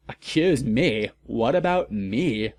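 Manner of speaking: usual speaking voice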